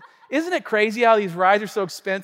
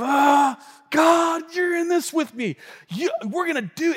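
mock eagerness; 9 semitones higher, 4 dB louder than usual. Acts eager believer addressing God.

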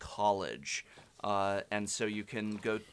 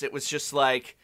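ordinary talk, nothing marked

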